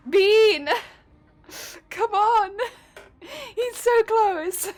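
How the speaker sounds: shouting, laughing in frustration; raging gamer. Real, not acted. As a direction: yell at the screen. Yelling at killer, laughing.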